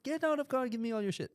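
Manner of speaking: high, put-on robber demand; mock African guard. Guard ordering tourists out of car, mock African guard. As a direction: hold them up in a comic high voice. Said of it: "the guards were like".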